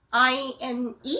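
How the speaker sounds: normal talking voice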